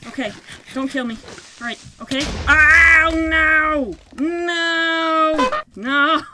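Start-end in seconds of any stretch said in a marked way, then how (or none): 2.5-6.2 loud despairing no; wailing cries. Real, not acted. Gamer overrun by mobs, loud despairing cries. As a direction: wail as you go down.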